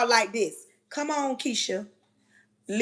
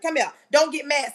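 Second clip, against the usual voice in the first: normal talking voice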